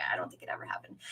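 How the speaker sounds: a breathy whispered aside, voice dropped away. Real, not acted. Doubting aloud about the never-built disco ball, whispered. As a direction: whisper it to yourself as an afterthought. Muttered aside after trailing off.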